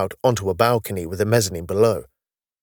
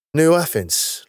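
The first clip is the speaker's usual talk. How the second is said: higher, rising surprise on New Athens; surprised echo; 7 semitones higher, slower than usual. Acted. Carter echoing the city name back to Denham, surprised. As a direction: repeat New Athens as a startled question.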